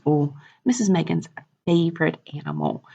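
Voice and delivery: ordinary talk, nothing marked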